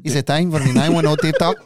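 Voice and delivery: laughing through a put-on English accent, rising at the end; mock English accent. Teasing his cohost with a mock English accent. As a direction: ham up an English accent on "Is it time for the nine one oh Tip Talk?". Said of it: "You have to say it with an English accent".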